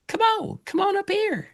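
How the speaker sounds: high sing-song pet-calling voice; cat voice. Real, not acted. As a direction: coax the cat in a very high, sweet voice. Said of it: "I used my cat voice".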